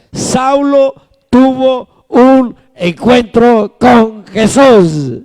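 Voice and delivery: slow, word-by-word preaching; deliberate, drawn-out declaration; emphatic proclamation. Real, not acted. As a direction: drive it home one weighty word at a time. Preacher proclaims the key point.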